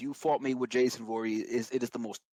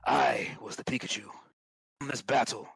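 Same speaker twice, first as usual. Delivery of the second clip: epic fantasy narrator. Shown as a demonstration. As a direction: tell it like an ancient war saga. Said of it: "I need to be more epic".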